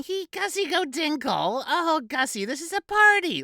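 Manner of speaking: high, squealing mock lady voice; party women mocking Gussie, high giddy voice; teasing party women. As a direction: taunt him in a giddy falsetto. Laughing women tease Gus.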